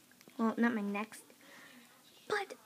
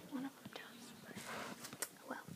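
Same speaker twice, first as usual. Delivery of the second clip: whispering; 14 dB quieter than usual. Real, not acted. Whispered gossip.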